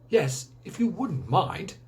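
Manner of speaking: slow, dry sarcasm; sarcastic. Acted. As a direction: drag it out as if it's all his fault. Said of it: "The tone was sarcastic".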